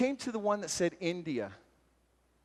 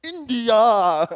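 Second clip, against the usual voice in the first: very loud, drawn-out shout, higher pitched; hammed-up voice of the Spirit calling; booming call from God; 5 semitones higher, 16 dB louder than usual. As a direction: boom it out as a comically insistent divine summons. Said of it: "like the Spirit of God going".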